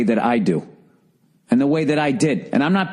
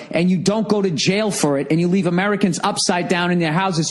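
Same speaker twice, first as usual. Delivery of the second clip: ordinary talk, nothing marked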